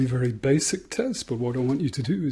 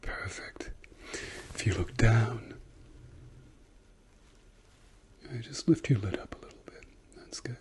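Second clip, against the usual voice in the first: whispering; 2 semitones lower, 8 dB quieter than usual. Acted. ASMR doctor exam roleplay.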